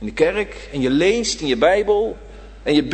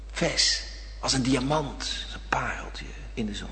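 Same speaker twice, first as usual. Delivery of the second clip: hushed whisper, drifting softer to a breath; soft awed whisper; 6 semitones lower, 11 dB quieter than usual. Real, not acted. Tender image of dewdrops.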